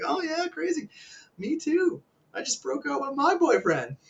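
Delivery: high falsetto playing along; mock sympathetic echo. Guy claiming the same breakup to a girl, mock falsetto. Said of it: "You're like".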